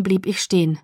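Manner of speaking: usual speaking voice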